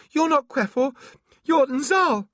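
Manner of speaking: very high, loud panicked cry; shrill panic. Acted. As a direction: shriek the accusation in fear. Terrified accusation.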